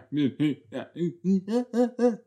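high rising giggle; giggling. Real, not acted. Amused by awkward flirting, high giggles. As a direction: giggle helplessly up the scale.

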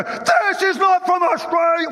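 high, hammed-up indignant protest; mock outrage. Preacher pretends to fume at a menu, mock outrage.

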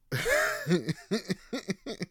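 high, wheezy run of chuckles. Real, not acted. Amused at his own ninja daydream, chuckling. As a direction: chuckle to yourself.

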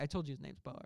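usual speaking voice

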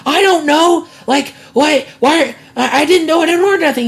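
high, insistent kid imitation; protesting kid. Kid cornered by mother over a package, mock pleading. Retold child insisting he ordered nothing.

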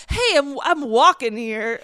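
loud, hammed-up street protest; indignant passerby. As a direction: call it out like an overacting extra. Acting out indignant one-line bit parts.

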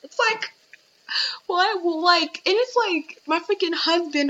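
high disbelieving what; incredulous squeak. Real, not acted.

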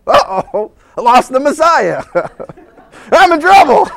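high, loud mock dread breaking into laughs; comic panic. Parent who lost the Messiah, comically panicking. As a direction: ham up a parent's sinking realization. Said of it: "there's the panic starting to come over 'em".

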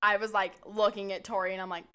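ordinary delivery

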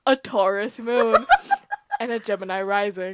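lightly voiced, laughing through it; a giggly half-done Ryan Bergara; Ryan Bergara impression. Cohost requests a Ryan Bergara take, done laughing. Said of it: "Now say it in Ryan Bergara".

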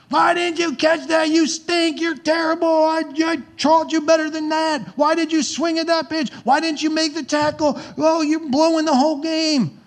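put-on high, harsh yelling at a kid on the field; furious dad shouting from the bleachers; berating sports dad. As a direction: scream at him like he just lost the game. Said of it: "Yelling and screaming".

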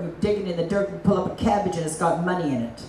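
usual speaking voice

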